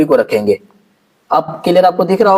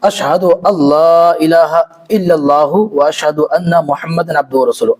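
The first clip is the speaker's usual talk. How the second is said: slow prayer recitation; 3 semitones lower than usual. Shown as a demonstration. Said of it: "वहाँ पे उंगली उठाना है".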